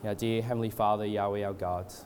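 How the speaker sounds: hushed-down, even, halting extempore prayer; leading prayer. Real, not acted. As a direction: pray aloud reverently, feeling for the words. Leads the congregation in prayer.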